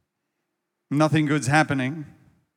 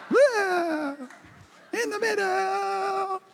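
much higher, slow imitation of a friend's laid-back voice; Deo voice; much slower than usual. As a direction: pitch it way up and drawl it out like Deo. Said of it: "Trying to do my Deo, uh, voice".

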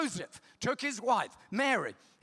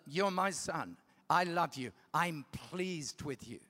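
soft, lower, slowing blessing; tender father; 6 semitones lower, 5 dB quieter than usual. Acted. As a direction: speak gently like a father to his child. God's tender words to son.

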